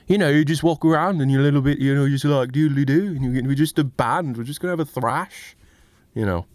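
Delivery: high, fast mock Liverpool voice; a loud lark of a Scouse accent. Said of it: "or you're the Beatles".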